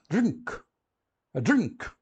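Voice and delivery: "drink" with an oral i, then ng-k; correct English pronunciation. Shown as a demonstration. Teacher returning to the example, careful English "drink". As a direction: model "drink" as one English syllable. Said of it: "você tá falando incorretamente".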